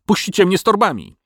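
high whining dragon. A narrator's reading. Dragon lamenting to the villagers, high and whiny. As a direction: complain you are ruined. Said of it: "jęknął Smok".

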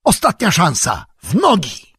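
high, urgent call to run; rally them to flee; urgent shout. A narrator's reading. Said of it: "krzyknął Gandalf". (wysoki, naglący okrzyk)

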